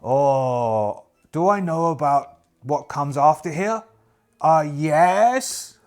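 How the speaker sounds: loud, drawn-out pondering trader voice; merchant character. Acted. Merchant asked what comes after here, loud musing trader voice. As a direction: draw it out like a knowing merchant.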